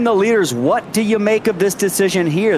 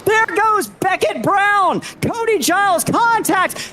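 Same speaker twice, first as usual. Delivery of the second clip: excited crash call; 9 semitones higher than usual. Real, not acted. Calling a sudden crash.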